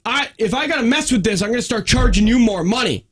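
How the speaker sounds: high-pitched mock angry threat; imitated mad man. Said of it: "he was all mad".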